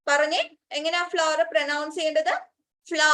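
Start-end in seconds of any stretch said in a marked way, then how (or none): 2.9-3.0 ഫ്ല as one long "flaa", no v; correct flower pronunciation. Shown as a demonstration. Teacher answering how flower is pronounced, correct pronunciation. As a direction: run ഫ്ല into one open syllable. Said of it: "എന്നാണ് നമ്മൾ pronounce ചെയ്യേണ്ടത്".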